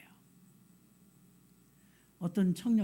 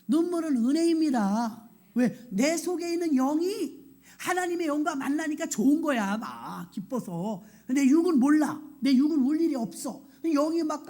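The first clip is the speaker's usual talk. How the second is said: loud, raised-pitch pulpit declaration; impassioned preaching; 6 semitones higher, 5 dB louder than usual. Real, not acted. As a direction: preach it out forcefully to the congregation.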